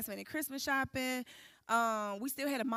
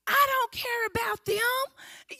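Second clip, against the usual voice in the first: defiant; 11 semitones higher, 5 dB louder, much slower than usual. Retold or imitated. Defying the officer in booking.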